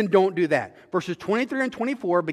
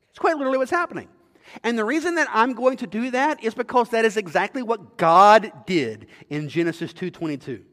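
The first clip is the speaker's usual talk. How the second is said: normal talking voice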